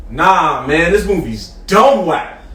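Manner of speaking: drawn-out, loud dismissal; loud blurt. Retold or imitated. Friend woken in a theater panning the movie, loud blurt. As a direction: blurt it out slow and loud, imitating a fed-up friend. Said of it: "Said it mad loud".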